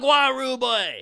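loud, high, drawn-out mock patois greeting; mock twang. Brit teasing his co-host's new twang, mock patois shout. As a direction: belt out a hammed-up patois twang. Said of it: "he's got a twang now".

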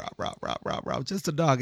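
breathy mock dog barks, soft; quiet imitated barking; imitating a barking dog. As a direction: rah rah like a vicious little dog. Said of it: "He sounds quite vicious".

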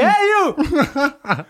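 high-pitched mock yell; imitated yell. Mimicking movie character yelling at girls, mock yell. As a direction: call out high like a movie drunk hollering at girls. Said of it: "he was yelling at these girls".

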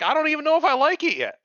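mocking the daughter in a high whine; mock teen girl. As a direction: squeak it like a defensive teenager. Voicing the teenage daughter.

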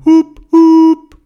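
two high, loud hooting blasts; truck horn honk. Acted. Lorry honks at the crossing animals, loud high hoots. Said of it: "der Lastwagen so gehupet hat".

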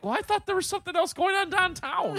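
high-pitched voice for the wife; imitating wife. Wife explaining what she thought, in imitation.

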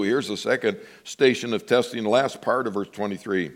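normal talking voice

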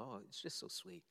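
ordinary talk, nothing marked

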